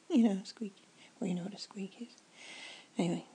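usual speaking voice

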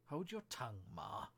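put-on mildly cross young crab; a touch of impatience with a parent; a little snappish. A narrator's reading. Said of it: "said the young crab a little snappishly".

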